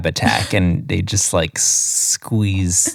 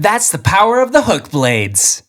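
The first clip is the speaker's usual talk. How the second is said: fighting game catchphrase; 10 semitones higher than usual. Pretend. Mock catchphrase.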